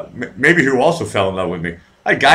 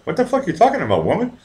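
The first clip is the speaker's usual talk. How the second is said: retold incredulity; 7 semitones higher, faster than usual. Retold disbelief at her proposal.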